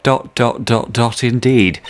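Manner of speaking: normal talking voice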